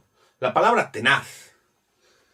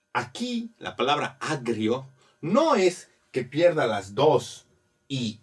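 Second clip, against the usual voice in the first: normal talking voice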